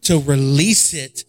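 slow, loud, fervent preaching cadence; drawn-out pulpit preaching over music; preaching at full force. Acted. Pastor exhorting the congregation, fervent preaching.

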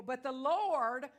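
preaching climax. Acted. Climactic scripture proclamation.

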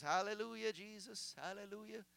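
soft, fading, mindless praise; absent-minded murmured repetition; rote prayer. Acted. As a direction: imitate praying by habit. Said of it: "we just say the same three things".

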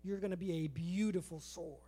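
low, soft reassurance; quiet, tender maker's voice; reassuring swordsmith. Acted. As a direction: gently promise something better. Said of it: "the person more molding that into a sword is saying".

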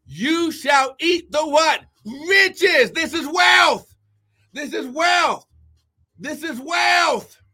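proclaiming shout. Real, not acted. Preacher promising riches to the congregation, proclaiming shout. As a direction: drive the promise home like a hammer.

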